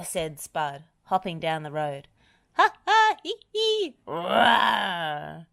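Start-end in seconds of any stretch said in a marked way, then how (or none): 2.5-5.4 high giggling cackle into a roar; gleefully hammed-up monster laugh; cackling mock roar. A narrator's reading. Spud laughs and roars, pretending to be a dragon, gleeful.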